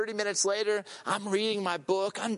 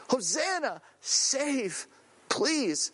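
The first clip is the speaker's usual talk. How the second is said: higher, understated retold plea; desperate cry; 5 semitones higher, much slower than usual. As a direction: call it out word by word, pleading. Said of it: "this is a desperate cry".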